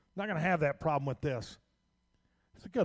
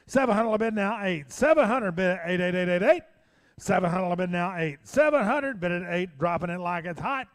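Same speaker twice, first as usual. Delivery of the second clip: fast rhythmic auction chant; auctioneer; 2 semitones higher, 4 dB louder than usual. Acted. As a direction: call the bids like an auctioneer. Auctioneer bid calling.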